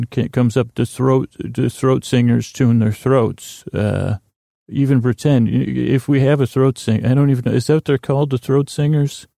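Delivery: usual speaking voice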